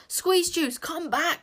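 high, loud call; roar. A narrator's reading. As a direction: roar after him. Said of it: "roared Zar".

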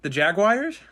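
mock disbelief. Real, not acted. Co-host teasing the pronunciation, says it in mock disbelief. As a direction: pretend you can't believe how he said Jaguars. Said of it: "That's just me being a dick".

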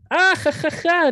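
high-pitched imitated commenter's laugh; mock laugh. Someone comments on social media, mock laugh. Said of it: "alguien comenta y dice".

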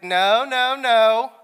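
high, loud insistent no no no; emphatic no. Retold or imitated. Telling his wife, a loud, high insistent no. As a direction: cut her off with a firm, high no.

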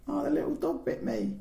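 put-on high, small child voice; small mock-childish cry; little sister imitation. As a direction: recall it in a high mock whimper. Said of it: "I always just think".

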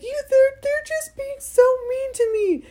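high, loud tearful whine; imitated crying. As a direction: wail it like an upset little boy in a mock cry. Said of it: "he started crying".

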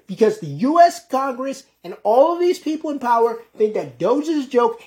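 usual speaking voice

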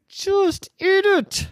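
high, slow exasperated order. Acted. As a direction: insist slowly, fed up. Dad insisting firmly.

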